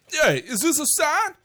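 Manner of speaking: loud, high, hammed-up Boston accent; exaggerated Boston accent; Boston accent attempt. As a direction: call it out in a bad Boston accent. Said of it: "I love doing a Boston accent".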